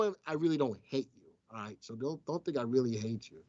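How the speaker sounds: ordinary delivery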